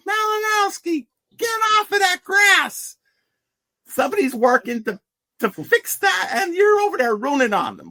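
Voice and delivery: hammed-up coach yelling at a player over the grass; Joe Paterno imitation. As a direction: yell at a player to get off the grass, squeaky and strained. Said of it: "That wasn't the worst Joe Pa imitation I've heard".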